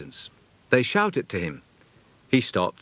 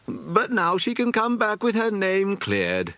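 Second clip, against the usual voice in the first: lightly voiced granny, slow and high; a grandmother sighing with relief, slowly; gentle old lady; 11 semitones higher than usual. A narrator's reading. Old Mrs Sonning relieved about her granddaughter, mild granny voice.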